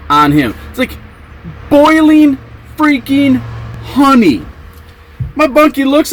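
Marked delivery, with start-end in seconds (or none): 1.7-4.4 "boiling freaking honey" drawn out slow and high; high-pitched, slow hammering of "boiling freaking honey"; emphatic word-by-word. Real, not acted. Teller repeats detail for emphasis.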